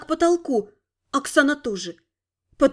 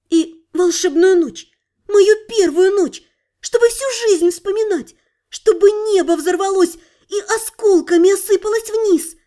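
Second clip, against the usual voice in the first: swooning romance quote; 5 semitones higher, 3 dB louder than usual. Acted. Oksana quoting a love novel to Sid, swooning. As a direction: gush like a romance novel heroine. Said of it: "Цитату из любовного романа".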